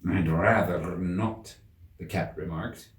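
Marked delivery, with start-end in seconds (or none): 0.1-1.6 slow, lazy, dry cat voice; cool cat refusal. A narrator's reading. Cat declines to kiss the King's hand, slow and dry. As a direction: drawl the refusal unbothered.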